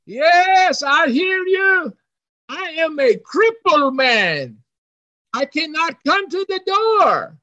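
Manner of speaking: high, sustained far-away call; hollering back. Retold or imitated. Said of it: "hollered back and said".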